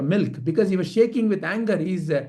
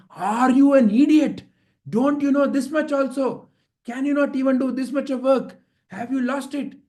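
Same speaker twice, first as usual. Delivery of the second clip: high, scolding outburst; furious king; 6 semitones higher than usual. A narrator's reading. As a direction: berate the servant as an enraged king. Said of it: "he got so angry and so upset".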